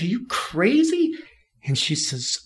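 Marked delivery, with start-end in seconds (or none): 0.0-1.1 retold anger. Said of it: "getting so mad at her".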